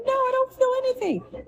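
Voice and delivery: high, upset denial; distressed answer. Retold or imitated. Patient answering the man touching her leg, high distressed denial.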